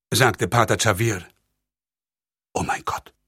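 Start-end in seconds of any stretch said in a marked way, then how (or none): 2.5-3.1 breathless, soft stammer; stammered in shock. A narrator's reading. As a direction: barely get the words out, shaken. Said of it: "stammelte der Generalvikar". (erschrocken gestammelt, fast tonlos)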